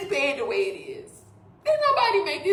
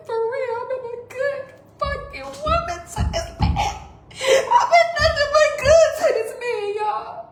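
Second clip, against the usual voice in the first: tearful; 6 semitones higher than usual. Real, not acted. Crying about being a good wife.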